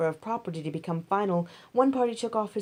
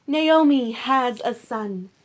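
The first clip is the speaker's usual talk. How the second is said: joyful announcement; 5 semitones higher, 8 dB louder, slower than usual. A narrator's reading. Women announce the birth.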